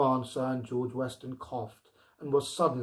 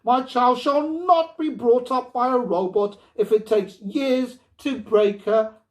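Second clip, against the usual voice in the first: loud, higher, firm refusal; grim resolve; 9 semitones higher, 9 dB louder than usual. A narrator's reading. Wife refusing to bring the robot back, loud and grim. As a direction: dig in and refuse to give way. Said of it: "she replied grimly".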